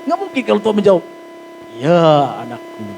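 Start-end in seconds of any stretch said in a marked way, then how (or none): 1.8-2.9 voice of God. Retold or imitated. Imagined God answering the pastor.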